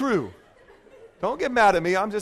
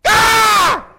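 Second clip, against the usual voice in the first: a loud high scream of shock on waking; 13 dB louder than usual. Acted. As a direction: scream as if the wrong face lies beside you. Jacob wakes beside the wrong bride.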